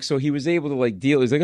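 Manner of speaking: ordinary talk, nothing marked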